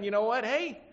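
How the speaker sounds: imitating a cheerful Paul who is not fine; mock cheery. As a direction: sing it out breezily, tongue in cheek. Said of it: "he's saying".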